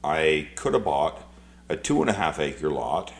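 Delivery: normal talking voice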